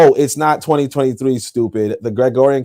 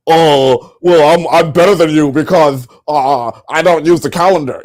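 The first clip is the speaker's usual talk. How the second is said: a put-on voice for a smug know-it-all; lightly voiced sneering braggart; mocking smug voice. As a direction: imitate a self-satisfied critic, mildly.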